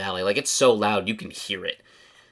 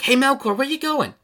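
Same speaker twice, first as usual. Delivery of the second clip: put-on high, bright-pitched girl's call; Sarah calling out. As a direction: call out to him in a much higher girl's voice. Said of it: "you hear the voice of Sarah as she's like".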